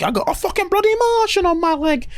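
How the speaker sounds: high-pitched mock wail; panicked complaint. Meep's holding on to her, panicked complaint. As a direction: squeal it in hammed-up exasperation. Character with Meep clinging on.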